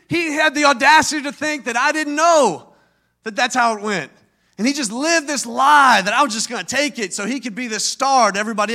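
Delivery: loud, heated sermon delivery; preacher driving the point home, loud; preaching at full force. Acted. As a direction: preach it with rising anger.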